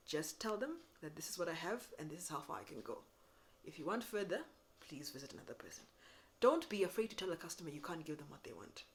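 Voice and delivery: ordinary talk, nothing marked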